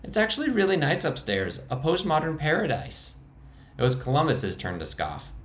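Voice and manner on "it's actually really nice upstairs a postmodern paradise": much higher, squeaking; squeaky high voice. Acted. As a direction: defend the hideout in a high squeak. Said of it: "the high voice making it a squeak".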